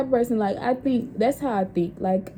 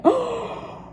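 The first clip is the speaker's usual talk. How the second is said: much higher startled little gasp; shocked gasp; 14 semitones higher than usual. Retold or imitated. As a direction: caught in the act, freeze. Caught by auntie, gasping.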